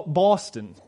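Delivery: usual speaking voice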